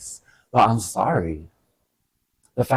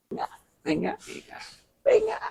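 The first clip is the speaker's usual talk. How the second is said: pinga squeaked up very high on the last one; playful high pinga; much faster than usual. Real, not acted. Savoring her favorite word, squealed high. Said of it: "But I love pinga".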